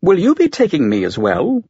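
a touch of doubt in the computer's voice; uncertain AI. A narrator's reading. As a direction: ask unsurely, lifting the pitch. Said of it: "uncertainty creeping into its voice".

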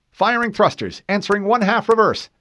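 high, crisp confirmation; helmsman reply. Acted. Helmsman confirming the all stop, high and crisp. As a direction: call it back sharply.

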